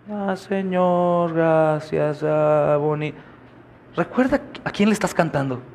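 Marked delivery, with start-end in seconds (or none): 0.1-3.1 cold bored worship. Retold or imitated. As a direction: drag the praise out lifelessly like you don't care. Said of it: "frío, sí". (alabanza fría y aburrida, arrastrada)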